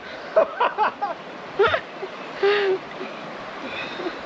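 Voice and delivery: shrieking laughter. Real, not acted. Laughing after cold plunge.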